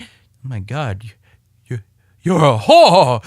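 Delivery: shocked puppet voice. Acted. Puppet skit, second hand voice.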